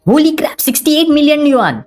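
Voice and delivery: high, thrilled yell at the win; excited shout. A narrator's reading. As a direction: shout it out, overjoyed. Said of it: "जोर से चिल्लाया". (ख़ुशी से ऊँची चीख़)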